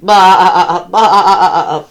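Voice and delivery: loud wavering baa; bleating sheep. A narrator's reading. Sheep greeting disguised Turkey, loud quavering bleat. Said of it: "bleated Sheep".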